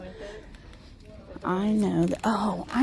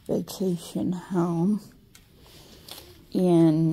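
usual speaking voice

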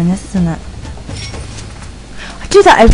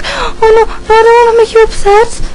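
much higher, a put-on little voice for the dog; high mock dog voice; 11 semitones higher than usual. Vlogger voicing her dog, high and fretful. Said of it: "she's like".

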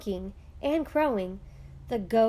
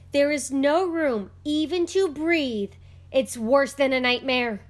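high and loud, at his wits' end; panicked shriek; 5 semitones higher, 6 dB louder than usual. A narrator's reading. Poor man pleading with the rabbi, panicked and loud. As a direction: beg to be saved from the chaos. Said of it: "he shrieked".

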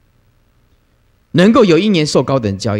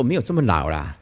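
much lower and softer, deadpan denial; amused protest; 8 semitones lower, 8 dB quieter than usual. Retold or imitated. Master gently protesting he is not that old, low and soft.

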